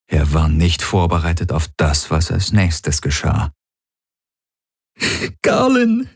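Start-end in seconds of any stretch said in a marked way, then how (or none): 5.4-6.1 high, sobbing cry of his name. A narrator's reading. Ylana sobbing as she throws her arms round him. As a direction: sob it out, much higher. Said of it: "schluchzte Ylana".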